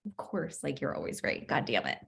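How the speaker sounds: quiet grumbling concession; grudging mutter. Pretend. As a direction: mutter it under your breath, defeated. Conceding to the smartass friend.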